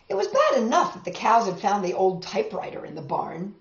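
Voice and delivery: ordinary delivery, nothing marked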